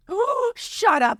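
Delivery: high gasp of surprise; gasp, thrilled disbelief; delighted gasp. Real, not acted. Delighted at Grey's Anatomy result.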